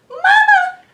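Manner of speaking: put-on high, loud scared baby cry; crying out Mama. Fluffy baby monster cries out for its mother. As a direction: call for mama in fright. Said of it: "cried the fluffy one".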